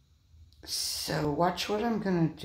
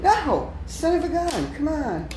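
high, loud cry of dismay; frustrated outcry; 10 semitones higher, 4 dB louder than usual. Real, not acted. Hobbyist crying out as his train fails, dismayed. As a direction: yelp at the failing train.

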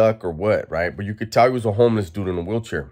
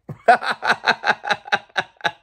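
a real high cackle of laughter; 13 semitones higher than usual. Real, not acted. Laughs at the lady's remark.

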